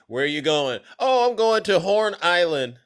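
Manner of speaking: ordinary talk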